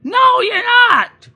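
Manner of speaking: high, loud, slow refusal; stretched, squealing no-way; mock firm no. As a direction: shoot it down hard. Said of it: "You're like".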